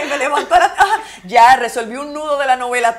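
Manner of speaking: loud, high, laughing through words. Real, not acted. Describing waking with a plot solved, laughing. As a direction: talk while laughing.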